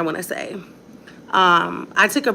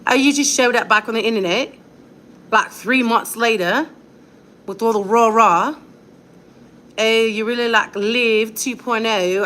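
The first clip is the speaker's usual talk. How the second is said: mock British accent; 2 semitones higher than usual. Mother mocking a commenter in a light British accent. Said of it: "Let me do it in my British accent 'cause it's so irritating".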